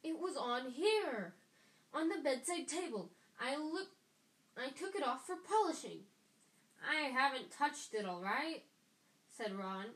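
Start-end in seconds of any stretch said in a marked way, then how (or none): 0.0-6.1 loud, high, indignant shout. A narrator's reading. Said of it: "Percy was shouting". 6.8-8.6 loud, defensive shouted retort; roaring back. A narrator's reading. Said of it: "Ron roared back".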